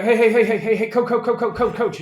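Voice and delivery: hammed-up eager quarterback, words tripping over themselves; Peyton. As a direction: badger the coach in an impersonated voice. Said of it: "I can do a decent Peyton impersonation".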